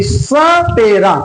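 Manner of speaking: सपेरा said slowly, stressing the dental स; slow careful सपेरा; slow demo word. Shown as a demonstration. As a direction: stretch सपेरा, tongue on the teeth. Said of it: "तो जीभ दांतों से टकरा रही होगी".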